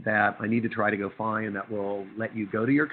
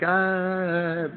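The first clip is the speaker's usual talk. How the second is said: startled gasp; 9 semitones higher, 5 dB louder than usual. Retold or imitated. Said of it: "all the professors are like".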